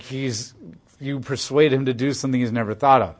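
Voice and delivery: ordinary delivery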